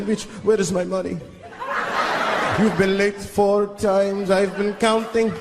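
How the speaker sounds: the Count shaking down his worker; pimp Count. Pretend. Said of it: "You just see him pimping".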